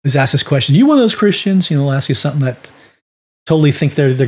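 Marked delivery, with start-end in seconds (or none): 0.7-1.7 a drug addict baiting a preacher, pitched up; challenging street addict. Retold or imitated. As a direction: throw the question like a dare.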